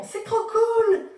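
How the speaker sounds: high, loud, drawn-out squeal of approval; gushing fans. Retold or imitated. Imitating people reading her t-shirt, gushing. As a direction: gush it high and loud, stretching the words. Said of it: "Ou encore ils font".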